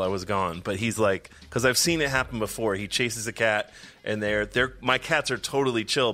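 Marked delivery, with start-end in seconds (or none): none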